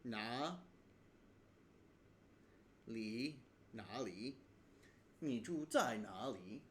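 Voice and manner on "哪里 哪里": 哪里 said slowly, syllable by syllable, li ending clear; standard 哪里 demo. Shown as a demonstration. Teacher to learners, slowly spelling out the standard 哪里. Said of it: "you would actually say".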